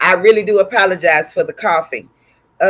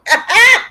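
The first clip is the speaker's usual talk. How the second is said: loud, high-pitched real laugh; burst of laughter; 14 semitones higher, 5 dB louder than usual. Real, not acted. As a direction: crack up at your own mistake. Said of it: "don't make me laugh".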